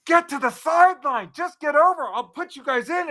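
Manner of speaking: high, loud sideline yell; yelling at kids. Retold or imitated. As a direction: call the kids off the field, hard. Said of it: "I'm yelling at my kids".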